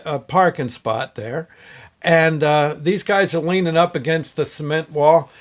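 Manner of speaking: ordinary delivery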